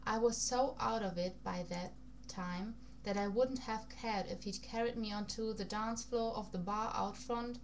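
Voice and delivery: plain reading voice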